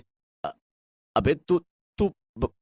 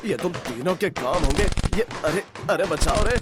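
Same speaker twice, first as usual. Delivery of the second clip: panicked shouting; 3 semitones higher than usual. Acted. Lala Ram yelling as crackers burst behind him, panicked shouting. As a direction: cry for help as firecrackers go off. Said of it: "लाला राम को चिल्लाते हुए".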